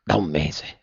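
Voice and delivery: the words knock the air out of her; stunned echo. A narrator's reading. Eugénie repeats the news, stunned and hushed. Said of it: "esclamò la povera Eugénie".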